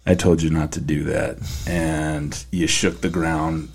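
quiet low scolding, slowed; low stern father. Pretend. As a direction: scold calmly from above. Heavenly Father scolding angel.

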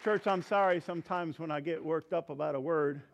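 ordinary talk, nothing marked

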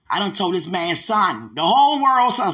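rising indignant shout; loud exasperated protest; exasperated outcry. Retold or imitated.

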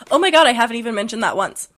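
normal talking voice

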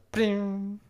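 high, drawn-out sung "bing"; slow, pitched guitar-note noise; imitated guitar string. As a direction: mimic a plucked string ringing out.

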